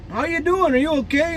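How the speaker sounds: anxious check-in from the guys, voiced high; worried teammates leaning over a dazed player; concerned teammates. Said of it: "They're all like".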